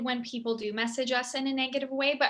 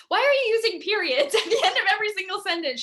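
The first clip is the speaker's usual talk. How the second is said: higher, louder, quick, laughing through it; laughing accusation; 8 semitones higher, 6 dB louder, faster than usual. Retold or imitated. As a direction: tease her sister while laughing. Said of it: "I'm like".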